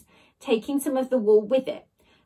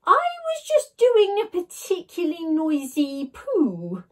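high, slow flustered splutter; 7 semitones higher, 3 dB louder than usual. A narrator's reading. Ned spluttering an excuse through the door, flustered. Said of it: "spluttered Ned".

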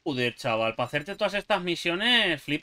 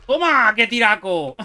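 triumphant shout; 5 semitones higher, 8 dB louder than usual. Real, not acted. Lands a great shot in game.

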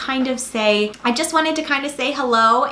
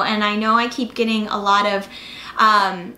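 usual speaking voice

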